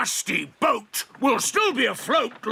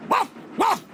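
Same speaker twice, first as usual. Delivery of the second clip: dog bark; 9 semitones higher than usual. Acted. Dog barks.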